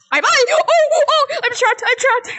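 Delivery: scared, high-pitched panic; panicked. Real, not acted. Trapped by monsters.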